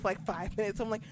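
normal talking voice

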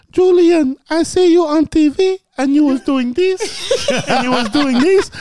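high-pitched, loud mom impression; put-on mom voice, way up high; imitating mom. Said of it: "My mom once saw him".

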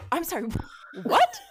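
rising to a high, laughing what; disbelieving laugh on what; shocked. Retold or imitated. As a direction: caught off guard by her son's swearing, shocked laugh. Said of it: "And I was like".

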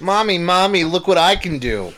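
put-on high, loud kid calling Mommy over; child showing off. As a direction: play an excited little kid.

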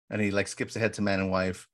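ordinary delivery, nothing marked

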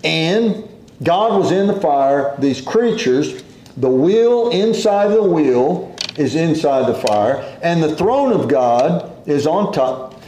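ordinary talk, nothing marked

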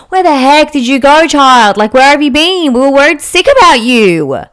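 high and loud, a panicked telling-off; frantic parents scolding. Retold or imitated. Parents rushing to their runaway daughter, loud and shrill with worry. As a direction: scold the child, half frantic and half relieved.

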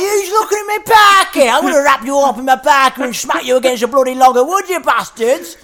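shouted, high Mancunian threat. Acted. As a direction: rant like a furious Manchester rock star. Acted rant as [name].

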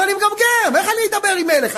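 high-pitched, quick objection; pleading protest. Acted. Stutterer telling God he cannot face a king, pleading protest. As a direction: plead unfitness, voice jumping up.

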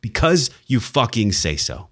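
"fucking" punched for emphasis, slow delivery; emphatic. Real, not acted. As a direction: lean hard on "Because" and "fucking", spacing the words out. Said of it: "important to put emphasis on words".

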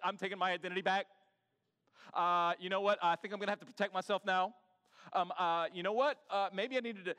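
loud, quick, self-protective inner voice; imitating a spouse who is shutting down; defensive spouse. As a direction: snap back fast like a hurt partner. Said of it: "And you're like".

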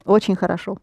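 ordinary delivery

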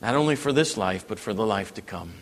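ordinary talk

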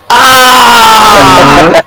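sudden high shocked shout; shocked yell. Retold or imitated. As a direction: yelp in sudden realization. Realizing the carrot joke.

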